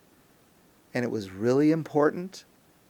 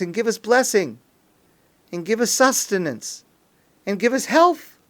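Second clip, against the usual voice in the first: raised, louder, impassioned pleading; fervent prayer; 4 semitones higher, 5 dB louder than usual. Acted. Congregation asking God for the new month's blessings, fervently. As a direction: pray it with feeling, pressing each request. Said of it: "we should say it with feeling and emotion".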